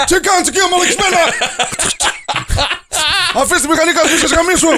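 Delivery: hammed-up armed old man yelling from indoors; threatening homeowner. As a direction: scare the thieves off sight unseen. Threatening voice from inside house.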